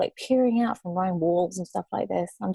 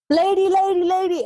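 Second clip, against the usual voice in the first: high, raised calling out; imitated urgent shouting; 13 semitones higher, 4 dB louder than usual. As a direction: call after someone on a departing bus, acted out. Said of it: "I hear this shouting from outside the window".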